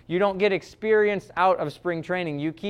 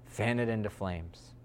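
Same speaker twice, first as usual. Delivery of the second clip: much softer, held down low; soft command; 9 semitones lower, 11 dB quieter than usual. Retold or imitated. Preacher quoting Paul to the congregation, hushed. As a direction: breathe the command intimately.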